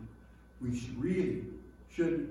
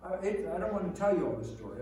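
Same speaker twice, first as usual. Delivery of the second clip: ordinary delivery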